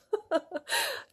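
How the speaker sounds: high rising laugh at a cheesy line; high giggle at her own cheesiness; embarrassed laugh. Real, not acted.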